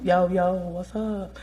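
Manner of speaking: soft, slow, laid-back "Yo, yo, what's up?"; slangy greeting. Shown as a demonstration. Lecturer demoing a casual street greeting to students, soft and slow. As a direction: drawl it out casually like a buddy. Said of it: "Is it tone?".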